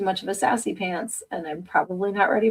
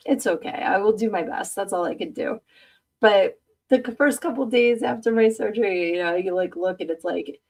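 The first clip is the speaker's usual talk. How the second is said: normal talking voice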